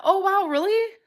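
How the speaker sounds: high, loud oh wow really; bright jump of surprise up high; surprised. Real, not acted. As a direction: caught off guard, voice leaps up. Reacting to cousins comment.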